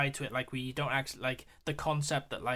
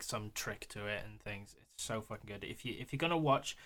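usual speaking voice

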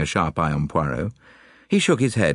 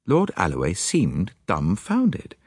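ordinary delivery

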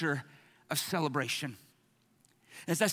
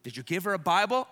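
ordinary talk

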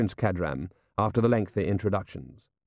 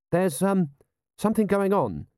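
uneasy; 9 semitones higher than usual. Acted. Lieutenant uneasily interrupts his lord.

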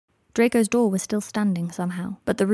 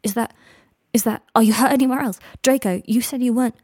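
louder, choked urgent questions; rough, urgent; 4 semitones higher, 7 dB louder than usual. A narrator's reading. Harry checking Draco's injuries, rough and urgent. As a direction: push through a catching throat. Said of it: "voice rough in his throat".

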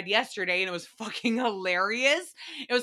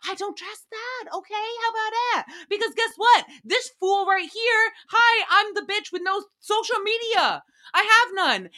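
much higher, louder sassy comeback; sassy rant; 10 semitones higher, 3 dB louder than usual. Real, not acted. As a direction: fire it back at him with attitude. Ranting back at Joey.

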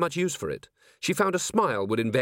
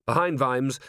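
ordinary delivery, nothing marked

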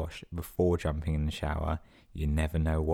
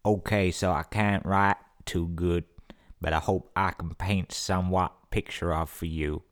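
a lightly put-on struggling reader; mock semi-literate reading. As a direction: read it out like someone who can't write well. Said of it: "read this sentence in the way this sentence should be read".

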